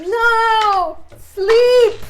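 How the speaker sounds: high, loud, frustrated yell; desperate shout. Acted. Player tries a sleep spell as it disappears, desperate shout. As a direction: shout the spell after a fleeing creature.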